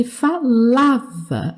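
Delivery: "falava" with a stronger middle syllable LA; stress on LA. Shown as a demonstration. Teacher pointing out the strong syllable, stress on LA. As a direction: lean on LA in "falava". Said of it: "aqui é mais forte".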